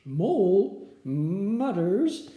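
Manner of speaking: plain reading voice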